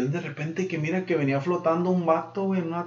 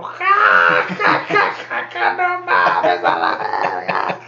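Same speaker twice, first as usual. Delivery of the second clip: donkey guffaw; 8 semitones lower, 5 dB louder, much slower than usual. Acted. Donkey laughs at the whisper in its ear, shouted braying laugh. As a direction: bray with laughter, then growl out the swear.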